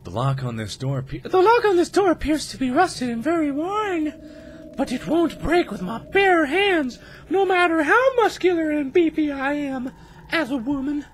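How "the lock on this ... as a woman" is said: high, slow put-on heroine voice; high-pitched Emma voice. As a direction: read the note as Emma, high. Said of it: "my Emma voice".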